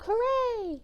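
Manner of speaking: high, sustained little hooray; hammed-up celebratory cry; mock crowd cheer. Everyone cheering the new holiday.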